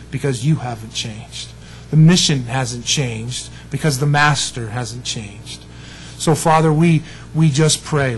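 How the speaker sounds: steady pastoral praying voice; closing prayer. Real, not acted. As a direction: lead the congregation in earnest prayer.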